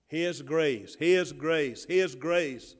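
loud "His grace", stress on "His" every time; preached refrain. Acted. Pastor driving home God's grace, loud repeated stress.

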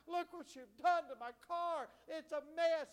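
loud, high hollering in imitation; retold screaming. Preacher imitating a car owner to the police, mock screaming. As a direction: mimic a hysterical woman hollering over damage. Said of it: "she's screaming, she's hollering, she's crying".

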